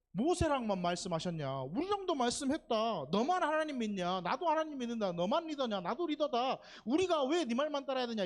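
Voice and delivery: high-pitched mock back-talk; acted defiance. Preacher acts Aaron and Miriam challenging Moses, mock defiance. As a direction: challenge the leader, pitching each retort up high. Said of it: "대드는 거예요".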